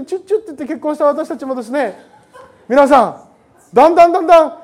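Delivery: ordinary delivery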